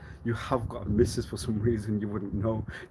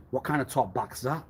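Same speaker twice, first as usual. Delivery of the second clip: ordinary delivery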